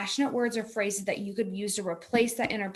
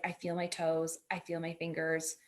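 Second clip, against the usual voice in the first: calm grounding; 5 semitones lower, 8 dB quieter than usual. Shown as a demonstration. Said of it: "wiggle your toe".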